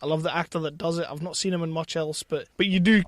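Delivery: usual speaking voice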